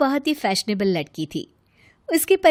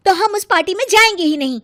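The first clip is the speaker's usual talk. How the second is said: higher, louder, fast sulky refusal; huffy refusal; 7 semitones higher, 6 dB louder, faster than usual. Acted. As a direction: stamp your foot and refuse fast. Rita sulks, refuses party.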